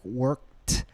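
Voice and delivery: "worked" ending in a crisp released t; final T sound. Shown as a demonstration. Teacher demonstrating an ending, final T on "worked". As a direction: say "worked" as workt, popping the t. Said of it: "We have the T E D sound".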